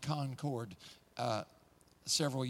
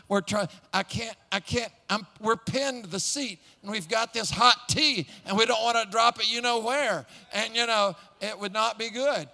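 higher and louder, laughing through the memory; excited; 7 semitones higher, 9 dB louder than usual. Real, not acted. Preacher reliving the afterburners kick with hot tea, excited. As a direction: relive being pinned to the seat. Pinned to seat holding tea.

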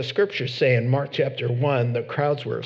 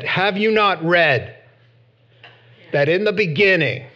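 louder, building higher to the end; preaching at full force; 4 semitones higher, 4 dB louder than usual. Acted. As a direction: proclaim it with rising authority. Preacher voicing Jesus' reply.

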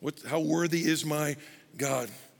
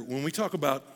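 ordinary talk, nothing marked

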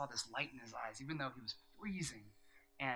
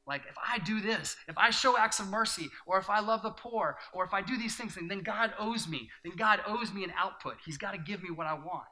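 loud, high, rising preacher's cry; full-force preaching; 6 semitones higher, 7 dB louder than usual. Acted. Pastor attacking the vending-machine view of God, preaching loud. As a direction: build it up and hammer the point.

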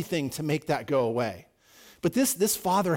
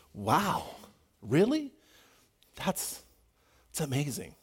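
dismayed wow; 5 dB quieter than usual. Acted. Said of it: "amazed at the lack of faith".

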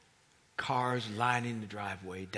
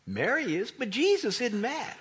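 usual speaking voice

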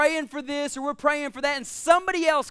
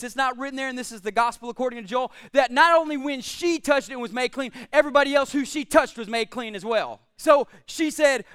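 ordinary talk, nothing marked

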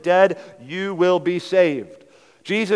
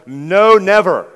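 "no, never" slow and much louder, both words punched; emphatic no, never; 3 semitones higher, 10 dB louder, slower than usual. Shown as a demonstration. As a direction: hammer down on "no, never". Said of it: "It's emphatic".

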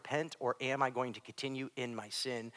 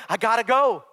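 raised, emphatic acted reply; loud insistence; 9 semitones higher, 13 dB louder than usual. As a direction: answer the pleas firmly at full voice. Acted Paul insisting he must go.

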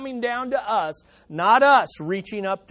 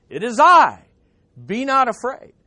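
proclaimed loud; a child booming out his one line; boy's stage line; 3 semitones higher, 9 dB louder than usual. Retold or imitated. Preacher voicing a boy's school-play line, loud and bold. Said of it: "the little boy ... had one line".

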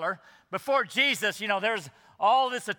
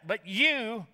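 "you" pitched up and stretched; emphatic you; 4 semitones higher than usual. Shown as a demonstration. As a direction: hit "you" high and hard.

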